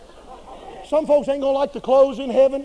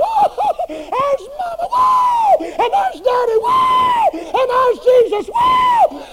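ecstatic shouting; 7 dB louder, much slower than usual. Acted. Excited reunion in heaven.

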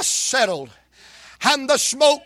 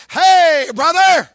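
loud, high call across the room; 6 semitones higher, 8 dB louder, slower than usual. Shown as a demonstration. Preacher calls loudly to a distant brother. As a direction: shout to a brother far away. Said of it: "I'm gonna have to speak up where he can hear me".

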